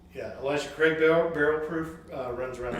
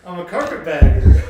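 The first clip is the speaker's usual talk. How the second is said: laughing; 3 semitones higher, 14 dB louder than usual. Real, not acted. Laughing repeat.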